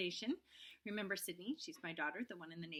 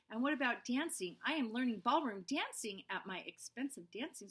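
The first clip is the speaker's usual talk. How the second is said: put-on lively storybook read-aloud for young listeners; reading to children; 5 dB louder than usual. Teacher reading Junie B. to her class, lively and animated. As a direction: read it big and bouncy, like story time.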